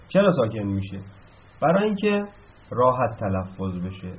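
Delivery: ordinary talk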